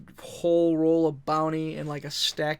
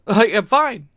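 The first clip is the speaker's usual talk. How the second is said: laughing it off; 6 semitones higher, 11 dB louder than usual. Retold or imitated. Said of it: "You know?".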